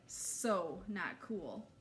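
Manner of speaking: very slow, stretched so not cool; girl shuddering at the lake; drawn-out dismay. Acted. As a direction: draw it out like a grossed-out teen.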